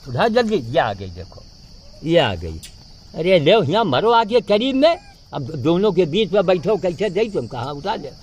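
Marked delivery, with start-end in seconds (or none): 3.2-8.1 louder and higher, urging the monkey to come close; calling out to monkeys. Real, not acted. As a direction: shout the monkey nearer, impatient. (ऊँची आवाज़ में बंदर को पास बुलाना)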